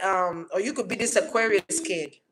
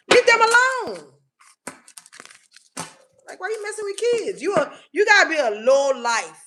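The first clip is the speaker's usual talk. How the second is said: much higher, slowed outcry; indignant outburst; 8 semitones higher than usual. Real, not acted. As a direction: cry out in protective outrage. Outburst defending children.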